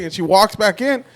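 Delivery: normal talking voice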